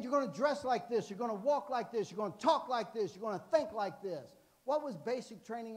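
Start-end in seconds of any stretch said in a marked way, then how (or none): 0.0-4.3 drill instructor orders. Retold or imitated. Drill instructors laying down rules.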